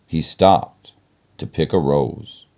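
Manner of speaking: ordinary delivery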